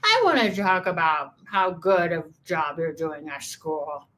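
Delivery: put-on slurred drunken mom or dad; mushy, boozy parent voice. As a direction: slur it like a tipsy parent. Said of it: "if your mom or dad was drunk and they're like".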